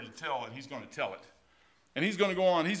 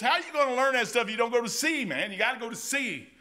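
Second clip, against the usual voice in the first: rising preacher shout; 6 semitones higher, 4 dB louder than usual. Real, not acted. Preaching at full force.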